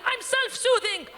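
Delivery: high, slow mock-weepy whine; hammed-up weepy insistence; tearful dad. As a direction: whimper it like a crying father. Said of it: "Dad, are you crying?".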